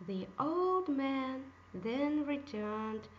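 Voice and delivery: ordinary delivery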